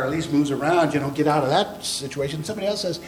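ordinary talk, nothing marked

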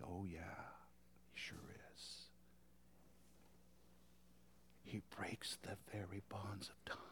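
soft whisper, full of wonder. Acted. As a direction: whisper it in awe. Awed whispered affirmation.

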